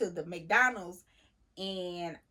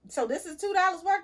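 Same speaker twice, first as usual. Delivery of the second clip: high, louder incredulous question; indignant disbelief; 10 semitones higher, 5 dB louder than usual. Retold or imitated. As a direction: demand it like you can't believe the price. Indignant at overcharge.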